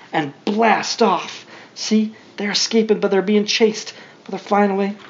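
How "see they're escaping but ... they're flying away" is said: ordinary delivery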